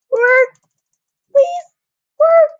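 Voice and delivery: high, pleading plea to the game; desperate high-pitched please; pleading please work. Real, not acted.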